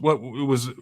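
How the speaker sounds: ordinary talk, nothing marked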